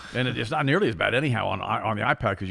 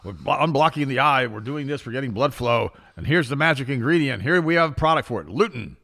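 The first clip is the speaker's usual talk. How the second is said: higher, louder sales spiel; mock infomercial pitch; 4 semitones higher, 6 dB louder than usual. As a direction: imitate an infomercial huckster's build-up. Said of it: "all these infomercials are coming out".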